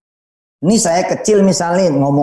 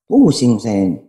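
mock doubtful prayer; 5 semitones lower than usual. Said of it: "doa juga kayak begitu".

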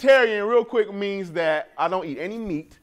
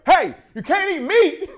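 higher, louder call across the room; mock yell across room; 9 semitones higher, 5 dB louder than usual. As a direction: holler it to the whole room. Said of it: "across the room yell".

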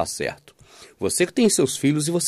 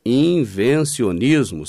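Invencionismo stretched very slowly with a falling end; drawn-out key word; 2 semitones lower, much slower than usual. Shown as a demonstration. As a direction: repeat Invencionismo slow and weighty. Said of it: "eu vou te dar o nome disso".

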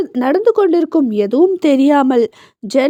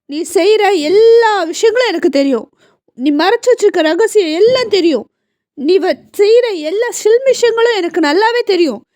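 son mocking his father, mildly angry and higher; angry retort; 6 semitones higher than usual. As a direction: taunt him back with a half-laughing scorn. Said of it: "என்று விஸ்வா கோபமாக கூற". (அப்பாவை கேலியுடன் கோபமாக எதிர்க்கும் மகன்)

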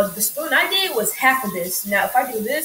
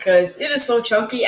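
ordinary talk, nothing marked

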